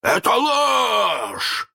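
high, drawn-out furious shout; hoarse roar. A narrator's reading. Hook denies the impostor, hoarse roar. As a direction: roar it out, stretching the last word. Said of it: "хрипко взревел Крюк".

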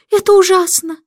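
lamenting queen. A narrator's reading. Queen wails over the king's idle answer, high and loud. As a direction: cry it out in dismay. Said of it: "запричитала королева".

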